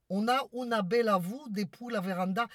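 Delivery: lightly imitating the maid's Italian accent; mock Italian accent. Mistress mimicking maid Maria's Italian accent, lightly mocking. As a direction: parrot her reply with a hint of Italian. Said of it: "Avec votre accent".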